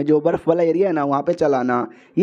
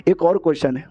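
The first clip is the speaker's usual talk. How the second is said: usual speaking voice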